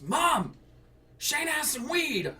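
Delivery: high, loud tattling shout to mom; retold brother yelling. Brother calls out to mom, high tattling shout. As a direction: yell it like a kid snitching.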